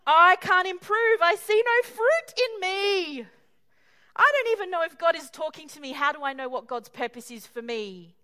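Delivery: hammed-up lament of a failing believer; anguished. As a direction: plead it out in despair. Despairing believer voiced in sermon.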